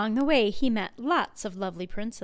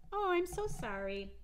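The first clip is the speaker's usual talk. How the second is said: ordinary delivery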